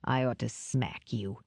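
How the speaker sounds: low, through gritted teeth; through clenched teeth. Acted. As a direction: grit your teeth and growl it. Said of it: "he said through clenched teeth".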